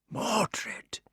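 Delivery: whispered name; king whispering in horror. Acted. King's shocked whisper.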